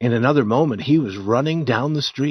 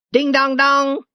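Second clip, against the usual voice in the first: high sung ding-dong like a ship's bell; bell imitation; 4 dB louder than usual. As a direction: ring it out like a riverboat bell. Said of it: "like a bell".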